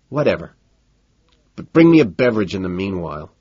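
usual speaking voice

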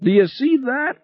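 very high-pitched amazed question; excited cry. A narrator's reading. Passenger to pilot on surfacing in sunlight, high excited cry. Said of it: "Harry cried".

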